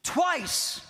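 usual speaking voice

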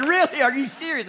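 high, loud, incredulous wife voice; a wife who can't believe what she just heard; wife's astonished reply. Retold or imitated. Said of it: "she said".

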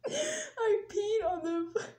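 high, slow squeak through laughter; too breathless with laughing to finish. Real, not acted. Storyteller losing it over the floor, laughing.

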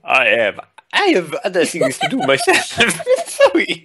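put-on French accent breaking into high laughter; panicked French accent. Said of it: "went into panic mode French".

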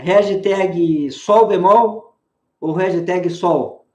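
very slow, drawn-out choices; slow teasing options. Shown as a demonstration. Teacher slowly teases the student with options. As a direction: stretch out the trick options. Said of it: "Duas chances".

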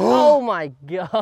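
loud, high, drawn-out gasp of amazement; amazed outburst. Real, not acted. Golfer reacting to a friend's shot, amazed and laughing. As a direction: laugh through a stretched-out exclamation.

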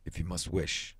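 low, subdued gruffness; gruff. A narrator's reading. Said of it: "he said gruffly".

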